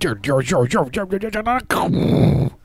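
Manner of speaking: stammering, pitch rising to a squeak; climbing stammer. Real, not acted. Stuck on a word, climbing up and up.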